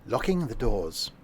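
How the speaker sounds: ordinary talk, nothing marked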